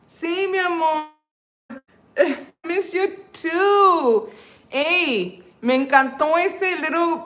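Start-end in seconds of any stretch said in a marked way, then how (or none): 0.1-4.3 sing-song reply. Real, not acted. Answering a fan's message.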